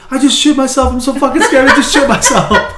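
high, loud frantic squeal; hammed-up panicked wail; mock panic. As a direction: imitate the terrified aunt shrieking. Aunt panicking, retold.

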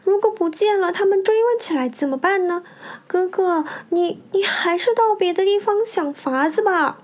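high, pleading woman's voice; worried young wife. A narrator's reading. Haitang turns away her brother, worried and pleading.

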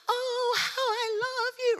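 high, loud, slow crooning coo; really high. Retold or imitated. Jen Stockman greeting her friend: very high, loud, slow coo. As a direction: coo it way up high with a tearful catch. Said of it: "really high".

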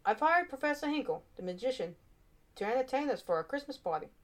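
teacher calling the class to order. A narrator's reading. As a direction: address the class as their teacher. Teacher voice in story.

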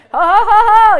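loud, high ho-ho laugh; hearty ho-ho on purpose; demonstrated laughter. Shown as a demonstration. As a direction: boom out a put-on laugh. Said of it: "you just start laughing".

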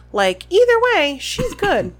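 loud, high sarcastic outburst; mock her scheme in disbelief; exasperated sarcasm. Real, not acted. Exasperated comment on Umbridge.

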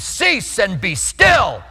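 mock shout, slow and drawn out; screamed at the wind. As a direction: yell the command at the gusting wind, half joking. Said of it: "I screamed at the wind".

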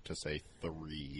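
slow, effortful "three" with a hard th; careful three. Retold or imitated. The teacher forcing out a careful, slow "three". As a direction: labour over the th in "three". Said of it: "he tried very hard".

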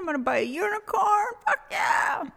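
loud, high, gleeful yelling; excited shout. Real, not acted. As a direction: shout it with wild glee. Excited shouting about a unicorn.

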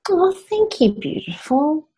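warmly moved; touched. Real, not acted. Touched by compliment.

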